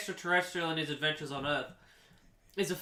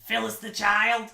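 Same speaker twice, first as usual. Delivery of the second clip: louder, higher, rough question; lightly voiced gruff alien asking around; smoker alien; 5 semitones higher, 5 dB louder than usual. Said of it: "she goes".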